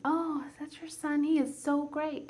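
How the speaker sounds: high, delighted imitation of another parent; gushing praise. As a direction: gush admiringly as an acquaintance would. Voices gushing parent at football stands.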